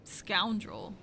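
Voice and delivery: ordinary talk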